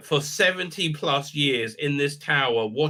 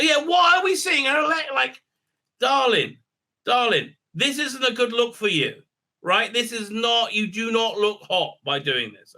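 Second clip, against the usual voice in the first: camp mock scold; 8 semitones higher than usual. Mocking camp talk to female character.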